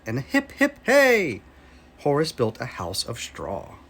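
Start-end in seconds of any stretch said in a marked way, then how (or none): 0.0-1.4 high, bright hip hip hey cheer; a happy little cheer. A narrator's reading. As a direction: cheer it out, pitched way up. Cheer while building.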